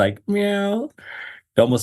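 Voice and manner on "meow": put-on high toddler meow; small child's high-pitched cat noise; two-year-old nephew. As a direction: meow like a little kid imitating a cat. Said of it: "a two-year-old nephew".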